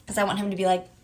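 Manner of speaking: usual speaking voice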